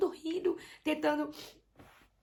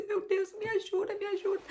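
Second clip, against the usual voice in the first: pleading prayer; 7 semitones higher than usual. Retold or imitated. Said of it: "a clamar por Deus".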